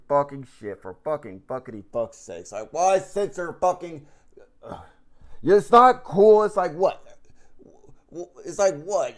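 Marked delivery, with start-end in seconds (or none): 5.4-9.2 slow angry shout. Real, not acted. Ranting about censored cuss words.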